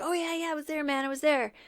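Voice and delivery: high, keyed-up name-dropper; eager witness. Retold or imitated. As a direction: blurt it like you're dying to be interviewed. Mark eagerly claiming to be a witness.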